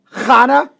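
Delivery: "Hana" starting on a throaty kh. Shown as a demonstration. Co-host showing what some people say, throaty kh. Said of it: "some people say".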